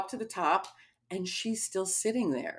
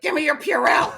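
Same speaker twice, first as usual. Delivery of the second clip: mock yell, higher and much louder; raised-voice retold demand; urgent demand; 7 semitones higher, 8 dB louder than usual. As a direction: insist loudly, imitating an impatient friend. Friend repeats her demand more urgently.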